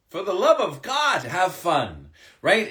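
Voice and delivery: ordinary delivery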